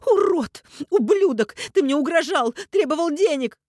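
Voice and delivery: very high, loud shouted insults; hysterical fury. A narrator's reading. Mother shouts at the kidnapper, hysterical. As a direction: shriek each accusation. Hysterical woman hurls accusations.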